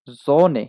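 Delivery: জোনে said loud with a falling tune; German word demo. Shown as a demonstration. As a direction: model জোনে for the class. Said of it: "জোনে অর্থ সূর্য". (German উচ্চারণে জোনে)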